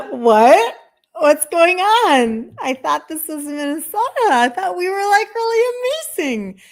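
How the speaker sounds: high, loud, stretched out what's going on; shocked disbelief. Retold or imitated. To viewers about brother's care, high incredulous squeal. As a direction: squeal in stunned disbelief at the system. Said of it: "was like".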